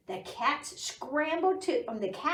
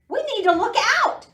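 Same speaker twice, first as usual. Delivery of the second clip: high, loud, fast bark of a warning; barking dog; 12 semitones higher, 10 dB louder, much faster than usual. Acted. Dog warning the others, loud fast bark. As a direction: snap the order out fast and loud. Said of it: "Dog barked".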